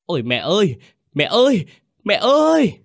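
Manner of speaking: hoarse-throated wail building to a shriek; dry-eyed howling. A narrator's reading. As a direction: scream out for mother, climbing very high. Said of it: "thì gào khan".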